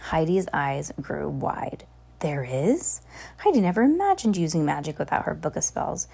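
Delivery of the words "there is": breathless whisper of wonder; a girl whispering in disbelief; awed whisper. Acted. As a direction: whisper it, amazed. Eyes wide in wonder.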